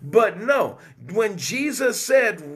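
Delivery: normal talking voice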